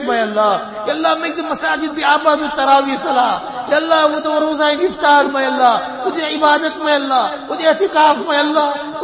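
loud, high fervent pleading to God; impassioned prayer. Acted. Impassioned dua before congregation.

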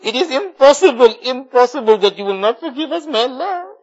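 ordinary talk, nothing marked

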